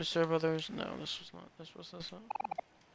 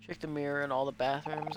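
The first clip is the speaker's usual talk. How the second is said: ordinary delivery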